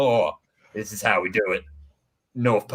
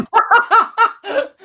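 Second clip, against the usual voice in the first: high hearty laugh; Santa laugh; 5 dB louder than usual. Acted. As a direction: jolly laugh at his own joke. Santa laugh after joke.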